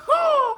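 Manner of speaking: real hearty laugh; big laugh. Real, not acted. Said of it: "laughing like a big dog".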